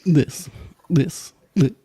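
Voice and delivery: loud, high stammering build-up; dramatic reveal. Acted. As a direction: announce it with a stammer.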